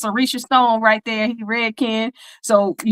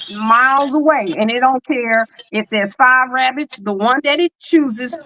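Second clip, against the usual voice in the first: ordinary delivery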